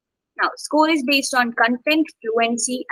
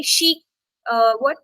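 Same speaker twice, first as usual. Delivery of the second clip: usual speaking voice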